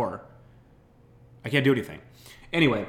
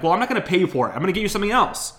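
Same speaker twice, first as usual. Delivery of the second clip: firm refusal; 8 semitones higher, 5 dB louder, faster than usual. Retold or imitated. Refusing dad.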